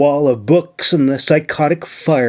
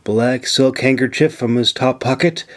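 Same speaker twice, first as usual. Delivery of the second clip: ordinary talk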